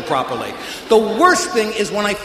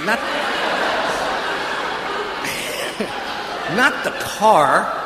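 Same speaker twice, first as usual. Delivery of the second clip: laughing, drawn out; 2 semitones higher, slower than usual. Real, not acted. Laughing, repeats himself.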